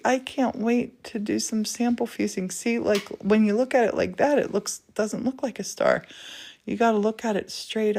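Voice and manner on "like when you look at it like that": ordinary talk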